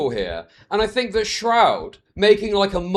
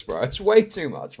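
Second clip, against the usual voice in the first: usual speaking voice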